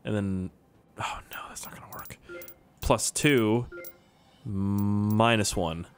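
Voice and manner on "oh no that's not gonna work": quick whispered oh no to himself; fast hushed panic under the breath; whispered worry. Real, not acted. Worried aside while solving.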